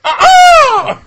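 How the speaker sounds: high loud wailing cry; mermaid call. Pretend. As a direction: sing out like a mermaid on a rock. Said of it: "sitting on a rock with my arms out going".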